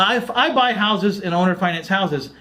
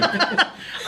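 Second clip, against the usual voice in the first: high airy burst of laughter; breathy laugh; 11 semitones higher, 4 dB quieter than usual. Real, not acted. Host amused by the deal story, breathy laugh. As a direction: let a laugh slip out.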